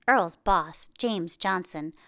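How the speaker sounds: usual speaking voice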